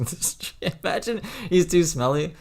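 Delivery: high, laughing through words. Real, not acted. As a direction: giggle while saying it. Host jokes, voice jumps.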